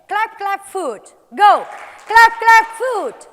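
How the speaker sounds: high, loud beat calling; energetic chant; loud rhythm calls. Shown as a demonstration. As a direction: call the beats out to the group. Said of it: "Give me your voice".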